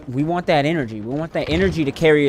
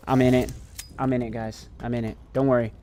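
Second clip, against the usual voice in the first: calming down; 5 semitones lower, 10 dB quieter than usual. Real, not acted. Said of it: "Sorry, I'm kinda wired".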